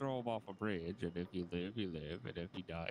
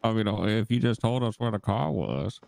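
loud interrogation; 14 dB louder than usual. Acted.

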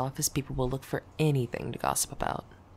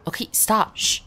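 urgent hush; 8 semitones higher, 7 dB louder than usual. Real, not acted. Hushing crying listener.

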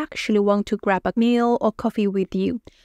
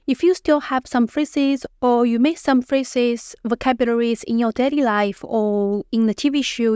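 normal talking voice

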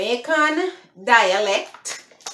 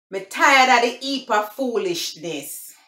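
higher-pitched, emphatic Jamaican patois; 4 semitones higher than usual. Shown as a demonstration. Vlogger demonstrating phrase number three, Jamaican patois. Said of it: "I'm gonna speak the Patois".

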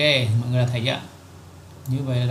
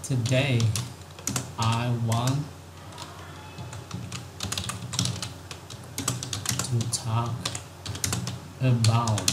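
slow word by word; 5 dB quieter, much slower than usual. Shown as a demonstration. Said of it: "tra phiên âm đấy".

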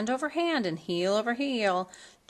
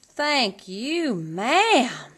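grateful cry; 5 semitones higher, 5 dB louder, much slower than usual. A narrator's reading. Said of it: "she cried".

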